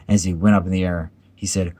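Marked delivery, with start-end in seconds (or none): none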